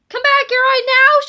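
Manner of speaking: loud, high yelled order. Acted. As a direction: yell after the fleeing boys. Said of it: "she yelled".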